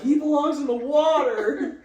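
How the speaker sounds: very high, loud, slow shout; protest shout. Retold or imitated. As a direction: yell it like an angry protester. Rival activists shouting.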